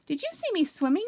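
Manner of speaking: excited squeak. A narrator's reading. Roo asks Kanga about his swim, excited squeak. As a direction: bounce with pride at your swim. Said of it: "squeaked Roo excitedly".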